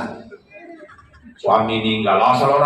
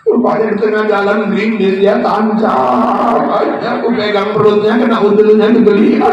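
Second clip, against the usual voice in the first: high, loud mock lament; hammed-up wailing tale; 7 semitones higher, 5 dB louder than usual. Widow wailing to mourners how her husband died. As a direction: wail the whole story like a grieving widow. Widow wails the accident story.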